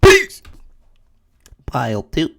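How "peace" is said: very high, loud yelp; high-pitched hollered farewell; shouted sign-off. Acted. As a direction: yell it as one short, high burst.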